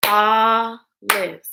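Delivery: olives in two separate beats; olives split into syllables. Shown as a demonstration. Teacher clapping along with pupils, olives split in two. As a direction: hold the first syllable of olives, then the second. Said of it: "Break words into parts".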